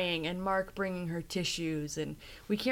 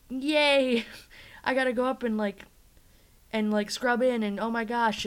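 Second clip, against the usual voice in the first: higher, louder giddy cheer; excited brag; 6 semitones higher, 6 dB louder than usual. Retold or imitated. Carter bragging to Susan about going up to surgery, giddy. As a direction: gush like an eager intern. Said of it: "he's just so excited to brag".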